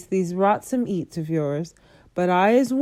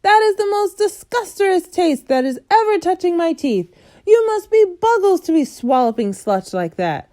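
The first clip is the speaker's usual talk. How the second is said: higher, loud ranting; raging giant; 9 semitones higher, 5 dB louder than usual. A narrator's reading. Bloodbottler ranting at the BFG, loud and high. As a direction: rant in disgust.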